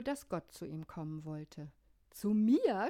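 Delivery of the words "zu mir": a louder startled cry of „Zu mir?“; shouted in disbelief. A narrator's reading. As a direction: cry it out, astonished. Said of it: "schrie er". (ungläubig lauter Ausruf)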